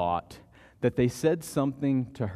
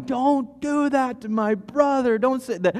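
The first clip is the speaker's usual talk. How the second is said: mild mock shout; 10 semitones higher than usual. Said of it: "someone is standing behind us shouting".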